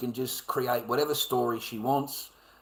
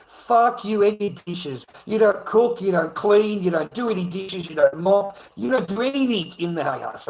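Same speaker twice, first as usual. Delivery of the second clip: loud, higher, furious listing of complaints; retold angry outburst; 4 semitones higher, 5 dB louder than usual. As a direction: yell every chore at her. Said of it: "I said to her".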